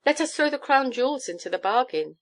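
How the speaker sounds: strained bargaining; gasped. A narrator's reading. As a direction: raise the bid in a mild panic. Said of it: "gasps out Giglio".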